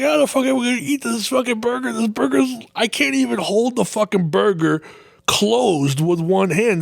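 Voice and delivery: high disbelieving rant; high, fast exasperation; incredulous. Retold or imitated. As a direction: sound overwhelmed by the burger. Retold disbelief at burger.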